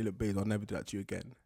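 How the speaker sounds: put-on low, soft, quick boyfriend promise; hushed mock apology; mock-sincere promise. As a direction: promise quickly under the breath. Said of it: "You say".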